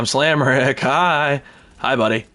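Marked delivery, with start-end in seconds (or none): none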